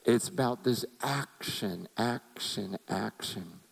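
sermon point dying off into quiet; fading repetition. Acted. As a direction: let the word action fade away. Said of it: "repeated word fading".

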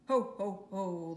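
spoken mock ho-ho laugh; hammed-up storybook laughter. A narrator's reading. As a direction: punch out a fake laugh, loud then falling away. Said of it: "laughed Kathy".

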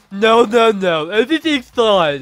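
loud high voice with a lisp; lisping princess voice. Acted. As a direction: lisp through the lines. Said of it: "huge lisp on your dialogue".

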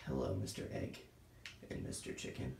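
low breathy whisper to the chicken; deep whisper. Pretend. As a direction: hush it down low, like a secret. Greeting the egg and chicken.

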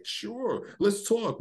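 high, soft mock willing reply; agreeable interviewee. People answering a reporter's approach, high agreeable voice. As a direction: play someone happily agreeing, high and soft. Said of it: "And people are like".

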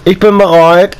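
usual speaking voice

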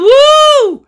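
long, high, loud whoop; high "woo" of delight; excited whoop. Real, not acted. Player skating very fast, whooping with joy.

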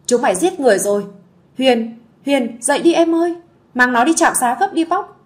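put-on sister-in-law screaming for help over a collapsed girl; panicked wailing. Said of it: "tiếng gào xé chát chúa".

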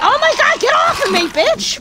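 high terrified shriek; panicked scream. Real, not acted. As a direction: scream as it grabs you. Zombie grabs player.

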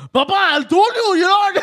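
high, loud mock Indian-accented yell at dad. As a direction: imitate a son yelling in an Indian accent. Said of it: "he'll be yelling at his dad".

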